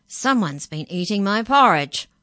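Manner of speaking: loud, indignant story-bear voice; Daddy Bear's complaint. A narrator's reading. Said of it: "Daddy Bear picked up his bowl and said".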